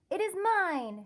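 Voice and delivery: demanding. Acted. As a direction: insist it's yours, no arguing. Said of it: "she demanded".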